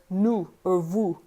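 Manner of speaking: 입술을 둥글게 뒤쪽에서 내는 nous, vous; 'nous', 'vous'를 깊은 ou 소리로 천천히; French ou. Shown as a demonstration. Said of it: "the O U sound".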